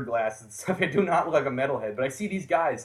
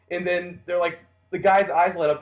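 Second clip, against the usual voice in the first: normal talking voice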